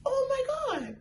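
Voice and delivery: tiny, very high squeal of shock; mock-appalled falsetto gasp; flabbergasted. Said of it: "I'm, I'm appalled, flabbergasted".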